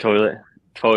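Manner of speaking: toilet with a clear oy sound; corrected "toilet". Shown as a demonstration. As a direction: say "toilet" as TOY-lit to be understood.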